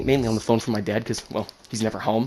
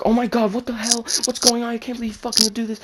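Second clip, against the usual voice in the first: much higher-pitched mock tirade; imitated outraged mom; 12 semitones higher than usual. Mom learns sister snuck out too, imitated outrage. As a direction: rail like an exasperated mother. Furious mom berates son in car.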